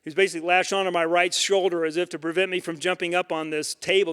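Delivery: usual speaking voice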